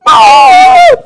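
put-on a sudden high, loud child's shriek; startled scream. Boy spotting giant dentures in a glass, shocked scream. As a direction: scream like you just saw something horrible. Boy sees huge false teeth.

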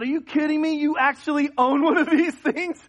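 high, loud, laughing excitement; thrilled disbelief. Retold or imitated. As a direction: gush with delight at the old car. Thrilled at seeing old car.

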